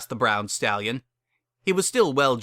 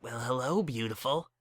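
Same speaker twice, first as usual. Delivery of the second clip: softer, tender hello on waking; soft warm greeting; 7 dB quieter than usual. Acted. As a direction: greet her lovingly, just waking. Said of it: "smiling with soft warmth".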